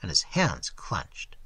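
plain reading voice

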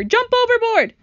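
loud, high urgent order; panicked shout. Acted. Grasshopper telling all to escape the Cloudman, shouting in panic. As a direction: yell it as an emergency command.

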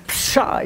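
"psha" whispered, a light sneeze; breathy mock sneeze; sneeze sound. Shown as a demonstration. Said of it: "that's where one of the words come from".

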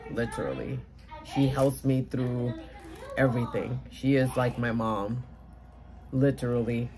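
low, soft, sincere confiding; heartfelt. Real, not acted. Crafter on a friend who is like mom, heartfelt. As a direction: speak softly and low, deeply moved.

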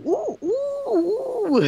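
very high, drawn-out mock oohs; crowd oohing. Students react to teacher's outburst, high mock oohing. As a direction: imitate a whole room going "ooh".

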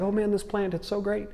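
high, soft mock delight; mock psyched. Jonah delighted with his shade plant, playfully psyched. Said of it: "Jonah's just super psyched about that".

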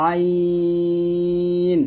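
long held "আইন" squeezed from mid-throat; pressed. Shown as a demonstration. Teacher demonstrating a throat letter, long pressed "আইন". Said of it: "দুটোর মধ্যে চাপ দিতে হবে".

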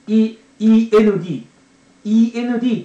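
イーエヌディー as long, loud Japanese letter names. Shown as a demonstration. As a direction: spell イーエヌディー out, stretching each letter. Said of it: "っていう名前の".

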